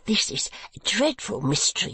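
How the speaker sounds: husband fretting to his wife; fearfully disconcerted. Acted. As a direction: voice the mystery fretfully. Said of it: "fearfully disconcerted".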